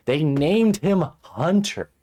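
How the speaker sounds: very loud, high, drawn-out disbelief; escalating outrage. Real, not acted. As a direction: cry it out slowly, utterly appalled. Repeats name in mounting outrage.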